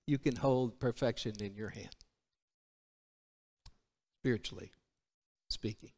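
low, soft reflective aside; hushed preaching. Real, not acted. Preacher likening Scripture to a newborn, hushed. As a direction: drop to a reverent hush, with long pauses.

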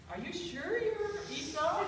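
put-on probing old father's doubt; suspicious questioning. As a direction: ask it slowly, testing his story. Isaac doubts the disguised son.

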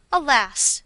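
heightened theatrical alarm; exclamation. A narrator's reading. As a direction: throw the word out in mock despair. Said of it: "exclaimed I".

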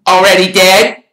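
loud, high interjection; helpful. A narrator's reading. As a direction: chime in loudly to finish it. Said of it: "said Ron helpfully".

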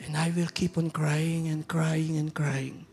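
slow broken whisper; whispered grief. Real, not acted. Pastor picturing the lost soul, slow whispered sorrow. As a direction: breathe it out slowly as if weeping.

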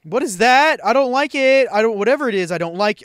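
loud panicked high yelling; freaked out, yelling in fright; scared. Real, not acted. Scared by game monster.

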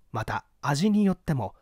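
ordinary delivery

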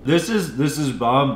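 talking between bites; speaking while eating snack; mouth full, chewing. Real, not acted. Seen 1: Both men bit food seconds before; speaker unsure, mouths working mid-chew.

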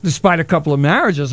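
normal talking voice

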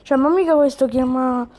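ordinary talk, nothing marked

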